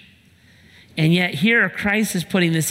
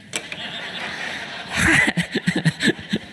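laugh; 5 semitones higher, 3 dB quieter than usual. Real, not acted. Pastor laughs at own joke.

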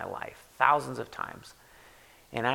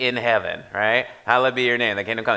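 rushed rote run; 7 dB louder, faster than usual. Shown as a demonstration. Pastor mimicking rushing through the prayer, rushed. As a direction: rattle off in heaven hallowed be your name quickly. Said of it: "really easy to keep going".

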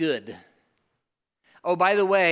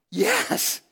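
high-pitched, overjoyed yes; amazed celebration; 3 dB louder than usual. Acted. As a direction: let the yes leap up in amazement. Acts out amazed celebration of gift.

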